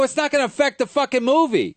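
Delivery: annoyed comic retort to sound guy; exasperated retort. Retold or imitated. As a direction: snap back at the crew in disbelief. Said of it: "I go".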